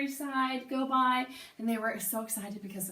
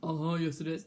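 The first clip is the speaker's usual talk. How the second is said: grandpa voice; 4 semitones lower than usual. Acted. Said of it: "your grandpa voice".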